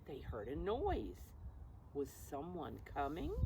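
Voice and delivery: ordinary delivery, nothing marked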